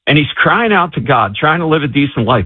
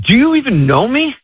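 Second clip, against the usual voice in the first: high, disbelieving challenge; incredulous retold question; 11 semitones higher than usual. Retelling a man texting an unknown sender, high incredulous question.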